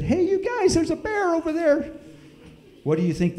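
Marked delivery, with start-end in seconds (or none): 0.0-1.9 high, loud frightened call from the van; scared call. Retold or imitated. Warning the family from the van, high frightened call. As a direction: call out high and urgent through the window. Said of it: "I said".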